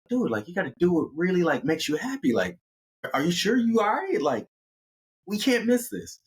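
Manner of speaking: high-pitched, earnest pleading; acted insistent pal; urging buddy. Buddy urging his hesitant friend.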